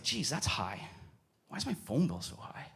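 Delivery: very soft, puzzled self-talk; musing to oneself. Retold or imitated. Man looking at his phone bill, quietly puzzled. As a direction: wonder about it quietly to yourself.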